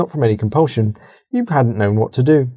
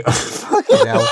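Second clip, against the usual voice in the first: ordinary talk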